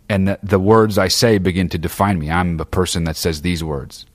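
ordinary talk, nothing marked

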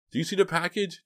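very high, hopeful question. A narrator's reading. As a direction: lift the voice high with hope. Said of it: "Dina asked hopefully".

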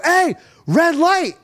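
high, drawn-out warning yell; alarmed shout. Retold or imitated. Warning a driver running a red light, high alarmed shout. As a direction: yell it slowly to jolt the driver.

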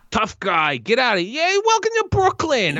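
hammed-up Brooklyn accent. Shown as a demonstration. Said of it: "Like that".

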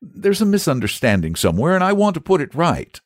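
put-on high boyish voice; a boy correcting the saint. A narrator's reading. Boy correcting Saint George, high and firm.

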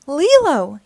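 loud, high sharp call of the name; a teacher pulling a girl off a classmate; teacher yelling. A narrator's reading. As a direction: yell the name to stop her. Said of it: "yelled her teacher".

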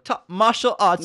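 high, loud, slow mock British; mock British accent. Cohost mimicking host's slip, loud mock British accent. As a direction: tease with an exaggerated British accent. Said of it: "You said martial like you're British".

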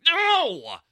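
frustrated no. Retold or imitated. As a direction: yell no at Siri. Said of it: "you're just like".

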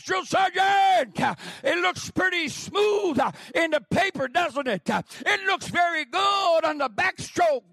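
loud, high sarcastic drill instructor yell; mocking drill sergeant. Drill instructor loudly mocking the recruit who can't swim. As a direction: taunt the recruit at full volume.

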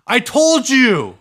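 told you so. Real, not acted. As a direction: gloat that you were right. Triumphant at being proven right.

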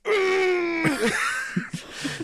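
long high-pitched comic scream; mock power-up scream. Acting out decompressing outside a bar, high comic power-up scream. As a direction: strain out an anime power-up cry, held back. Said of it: "screaming real loud".

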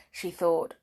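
ordinary delivery

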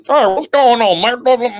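loud, high-pitched mock Southern babble; deep South accent. City kid mimicking Southerners he can't understand, mock deep South accent. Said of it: "like, a deep South ass accent".

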